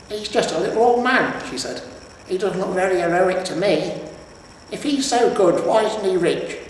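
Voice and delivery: high, scornful wife voice; scornful troll wife. A narrator's reading. Troll wife sneering at the hero, high and scornful. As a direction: belittle the old man.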